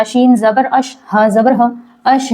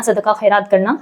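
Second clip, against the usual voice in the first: ordinary delivery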